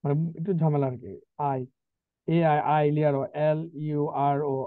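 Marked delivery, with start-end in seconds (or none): 1.4-4.6 spelling out slowly. Shown as a demonstration. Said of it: "এই বানানটা মনে রাখবেন".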